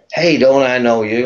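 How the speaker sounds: high-pitched imitation of an American girl; corny American accent. American girl accosting a man she recognises, corny accent. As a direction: mimic her American accent, pitched well up. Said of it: "I have to do this accent".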